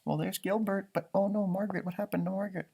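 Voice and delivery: ordinary delivery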